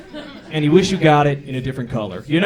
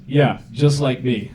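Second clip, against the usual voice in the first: deadpan agent; much slower than usual. Retold or imitated. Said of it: "the guy goes".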